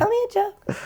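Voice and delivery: very high mock request; high, sing-song pestering; pushy stranger impression. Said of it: "everyone always says".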